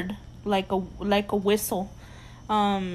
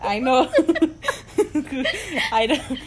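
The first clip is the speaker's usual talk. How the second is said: laughing; 3 semitones higher, 3 dB louder than usual. Real, not acted. Laughing about the name.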